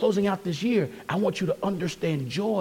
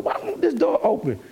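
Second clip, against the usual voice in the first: frustrated whine; 9 semitones higher than usual. Retold or imitated. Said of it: "You put".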